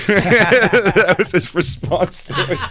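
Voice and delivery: high laugh through the words; slow, laughing it out. Real, not acted. Telling the manager story, laughing.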